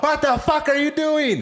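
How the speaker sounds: high, slow shocked yell; shocked girlfriend. Retold or imitated. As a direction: yell it like his stunned girlfriend. Girlfriend yelling after knockout.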